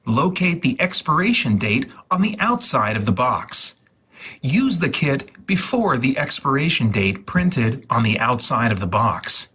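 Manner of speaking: ordinary talk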